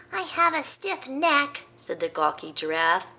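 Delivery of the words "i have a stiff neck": high, slow excuse; giraffe's excuse. Acted. Giraffe dodging the trip, high and slow. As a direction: plead a stiff neck.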